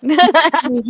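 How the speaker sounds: drawn out through a laugh. Real, not acted. Laughing after a lucky outcome.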